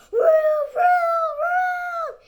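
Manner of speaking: very high, very loud howling wind; screaming whirl. Acted. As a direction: howl like a tornado. Tornado sound getting closer.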